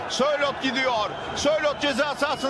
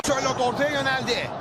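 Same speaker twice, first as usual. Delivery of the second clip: ordinary delivery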